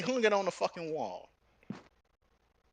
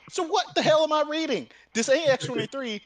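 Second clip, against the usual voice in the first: high, loud indignant complaint; loud incredulous griping; frustrated outburst; 9 semitones higher, 5 dB louder than usual. Retold or imitated. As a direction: push it out, exasperated.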